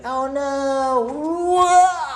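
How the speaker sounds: high, loud startled cry; hammed-up yelp while leaping; mock fright. Mole leaping away, hammed-up startled cry. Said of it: "so he's like".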